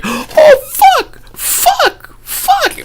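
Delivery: shrieking high-pitched curses, half laughing; startled cursing. Retold or imitated. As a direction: retell his panicked wake-up as a mock shriek. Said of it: "and he's like".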